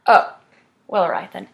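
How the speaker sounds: ordinary talk, nothing marked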